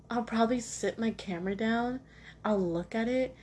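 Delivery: ordinary talk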